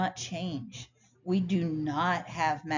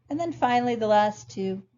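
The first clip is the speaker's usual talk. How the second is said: normal talking voice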